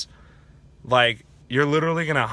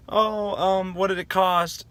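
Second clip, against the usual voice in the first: higher-pitched mock crying voice; imitated tearful girl; 6 semitones higher, slower than usual. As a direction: lightly imitate a girl asking through tears. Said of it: "crying, and he's like".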